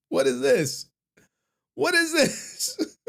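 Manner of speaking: high-pitched baffled outcry; hammed-up bewildered squawk; comic disbelief. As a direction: exclaim way up high, playfully appalled. Baffled by picture in shrine.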